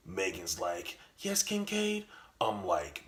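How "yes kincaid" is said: put-on much higher, sweet girlfriend voice. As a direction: answer him like a sweet girlfriend.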